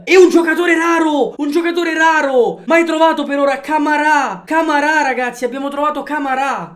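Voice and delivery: loud, thrilled shouting; excited. Real, not acted. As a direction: yell out the lucky find. Excited pack opening.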